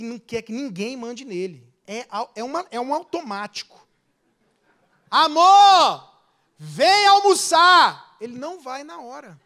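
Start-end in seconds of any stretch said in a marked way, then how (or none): 5.1-8.2 loud, raised call with stretched words. Acted. Wife calls husband to come to lunch, loud drawn-out call. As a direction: holler it across the house.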